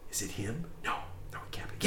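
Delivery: hushed crowd murmurs; crowd whispering. Retold or imitated. Game master voicing awed tavern patrons, whispering.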